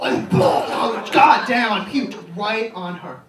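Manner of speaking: crude story shouted high and very loud; yelling. Retold or imitated. Shouting gross stories at a campus tour group, loud. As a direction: bellow it so the whole tour group hears. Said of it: "started yelling".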